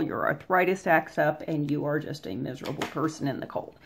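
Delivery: ordinary talk, nothing marked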